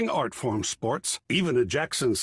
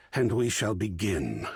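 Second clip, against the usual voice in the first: ordinary delivery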